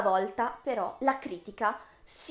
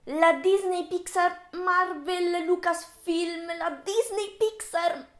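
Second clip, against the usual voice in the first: slow, drawn-out names climbing higher and louder into a scream; mounting mock panic; 7 semitones higher, 6 dB louder, slower than usual. Vlogger piling up Disney's acquisitions, mounting mock panic. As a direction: build to a hammed-up cry for help.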